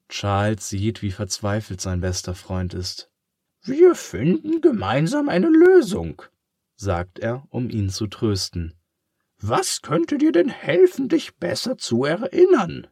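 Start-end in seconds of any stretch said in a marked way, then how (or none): 3.6-6.2 very high, loud pig voice, reassuring; comforting pig. Acted. Charles comforts his friend. 9.4-12.9 very high, loud pig voice, reassuring; comforting pig. Acted. Charles asks kindly.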